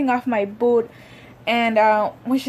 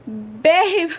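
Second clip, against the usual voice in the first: high, louder call to her husband; 8 semitones higher, 4 dB louder than usual. Retold or imitated. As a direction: call him over from the ground. Calling husband after the fall.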